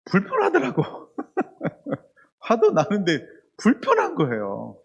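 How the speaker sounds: laughs through the confession, slowing down; chuckling at his own unease; laughing. Real, not acted. Pastor laughing as he admits his discomfort to the church.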